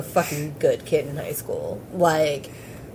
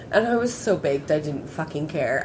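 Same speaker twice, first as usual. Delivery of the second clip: ordinary talk, nothing marked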